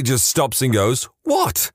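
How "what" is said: very high-pitched mock exasperated "what"; exasperated reply. A narrator's reading. Brother-in-law finally stops for the homeless man, exasperated. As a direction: read it as a put-upon, pitched-up question.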